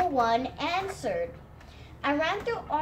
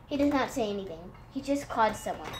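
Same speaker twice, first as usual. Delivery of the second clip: plain reading voice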